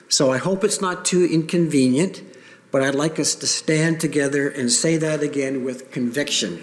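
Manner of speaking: ordinary talk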